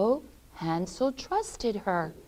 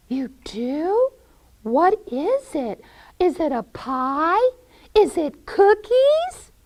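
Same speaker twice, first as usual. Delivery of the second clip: put-on higher little-girl questions; eager Gretel; 8 semitones higher, 6 dB louder than usual. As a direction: guess eagerly, pitch climbing. Gretel eagerly guesses what's baking.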